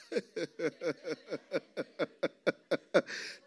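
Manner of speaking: long, steady, staccato ha-ha-ha; a humourless laugh that keeps going; not-good laughter. Acted. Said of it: "That's not a good laughter".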